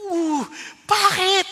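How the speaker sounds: high, slow, shocked uh bakit; drawn-out disbelieving question; hammed-up shocked husband; stunned. Shocked reply to wife.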